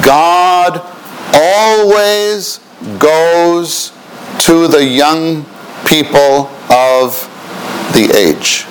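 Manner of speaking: very slow, stretched words; dictating. Shown as a demonstration. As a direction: draw out each word for writing. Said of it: "please write this".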